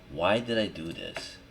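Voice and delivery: ordinary delivery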